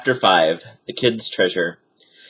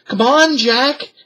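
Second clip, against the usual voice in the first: high, loud call to her brother; Annie shouting; 4 dB louder, slower than usual. A narrator's reading. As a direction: yell to Jack to hurry. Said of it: "Annie shouted".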